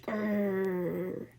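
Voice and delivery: a short rumbling growl; bear growl. A narrator's reading. Pressed teddy bear growling at Joe. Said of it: "An alarming growl came out".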